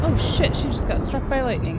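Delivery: sudden high, loud shock at the lightning strike; startled outburst. Real, not acted. Player sees her sim struck by lightning, shocked high loud outburst. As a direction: blurt it out, caught completely off guard.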